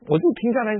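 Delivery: ordinary talk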